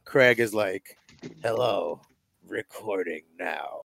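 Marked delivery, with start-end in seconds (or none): 1.4-3.8 low, slow, soft robot announcement; deep, quiet robot monotone; robotic Terminator voice. Retold or imitated. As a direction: announce it like a menacing machine. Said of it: "robotic ass Terminator voice".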